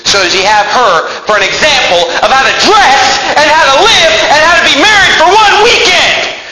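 mocking verse, building in pitch to a cry; mock exhortation. Preacher parodies scripture on celebrity role models, mockingly.

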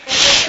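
short imitated burst of spray paint; spray-can hiss. Preacher mimes repainting the sign, spray-can hiss.

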